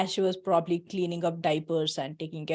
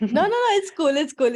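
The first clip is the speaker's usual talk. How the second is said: eager reassurance; 8 semitones higher, 7 dB louder than usual. Real, not acted. Reassuring flustered guest.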